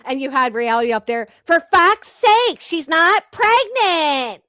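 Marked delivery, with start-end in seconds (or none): 1.5-4.4 much higher, louder and very slow put-on voice; exasperated impression. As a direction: drag it out in a shrill mocking character voice. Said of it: "You did that way too well".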